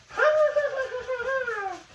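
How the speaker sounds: high shrieking cackle; high witch laugh. Retold or imitated.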